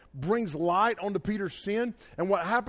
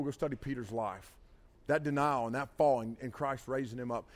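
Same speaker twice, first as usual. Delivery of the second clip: tender reflection; 4 semitones lower than usual. Real, not acted. Pastor softens to tender reflection.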